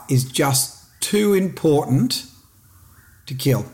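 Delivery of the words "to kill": ordinary delivery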